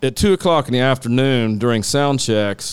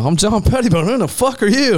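cocky comeback; 11 semitones higher, faster than usual. Retold or imitated. Retold comeback to the tech.

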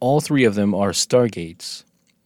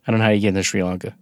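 ordinary delivery

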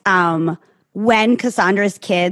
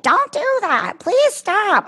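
pleading high voice; 12 semitones higher, much slower than usual. Retold or imitated. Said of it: "me being like".

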